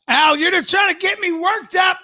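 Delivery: very high put-on protest; mock worked-up. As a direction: protest in a squeaky, hammed-up voice. Mock outrage at listener's teasing.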